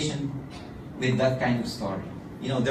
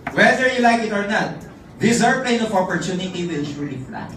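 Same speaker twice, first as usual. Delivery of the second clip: loud high motivational rally; rally speaker's big finale; rousing closing speech; 4 semitones higher, 7 dB louder than usual. Acted. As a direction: build the crowd up to the finish.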